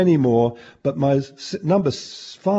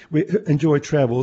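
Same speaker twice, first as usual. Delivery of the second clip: usual speaking voice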